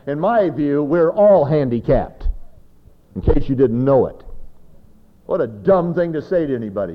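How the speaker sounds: fiery pulpit rebuke; full-force preaching. Real, not acted. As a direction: drive it home to the congregation.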